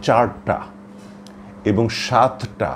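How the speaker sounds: slow, careful চারটা and সাতটা, each word set apart. Shown as a demonstration. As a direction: say চারটা and সাতটা slowly and deliberately. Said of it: "উচ্চারণে আমাদের বাকযন্ত্র খানিকটা বাধাগ্রস্ত হয়". (ধীরে, যত্ন করে উচ্চারণ)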